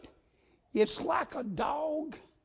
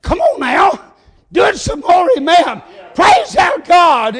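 loud, higher shouted urging, preacher style; God; 7 semitones higher, 18 dB louder than usual. Acted. Preacher voicing God answering their praise, shouted urging. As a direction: cheer the worshipers on at full voice. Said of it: "and he's saying".

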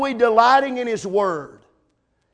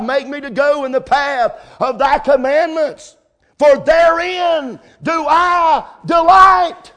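preaching at full force; 6 semitones higher, 6 dB louder than usual. Acted. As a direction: drive it home, louder, rising to a cry. Preaching builds to a shout.

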